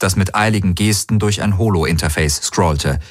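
plain reading voice

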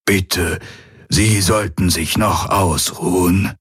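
slow, aged voice of concern; murmuring old man. A narrator's reading. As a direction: plead gently as an elderly attendant, taking your time. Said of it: "murmelte der greise Aur".

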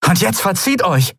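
high, firm order; commanding dismissal. Acted. Endriel dismisses the pirates, commanding. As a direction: send them away with authority. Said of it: "befahl Endriel".

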